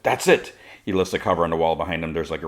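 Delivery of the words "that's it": higher-pitched retold snap of temper; fed-up grumble. Goaded Toyman reaching his limit, fed up. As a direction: lose patience, mildly acted. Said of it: "he grumbles, like".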